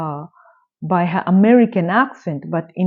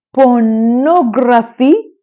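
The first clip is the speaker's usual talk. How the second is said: incredulous; 3 semitones higher, 6 dB louder, much slower than usual. Real, not acted. Disbelief at the nun's film.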